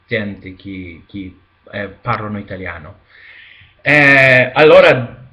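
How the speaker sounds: ordinary delivery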